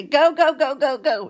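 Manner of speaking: high, loud, impatient shout; a stroke-stricken grandpa fed up with the questions; exasperated yell. Retold or imitated. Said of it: "and he'd say".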